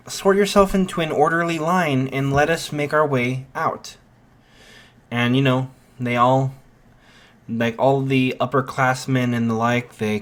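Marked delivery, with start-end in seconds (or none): 0.1-4.0 higher-pitched, orderly instruction; acted orator giving orders; addressing a crowd. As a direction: direct the students in a put-on voice. Ida still addressing panicked students.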